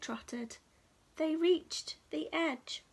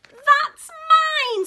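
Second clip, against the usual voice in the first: very high, loud squeaky squirrel; squeak; 14 semitones higher, 16 dB louder than usual. Acted. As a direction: squeak it out like an angry little squirrel. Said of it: "squeaked the squirrel".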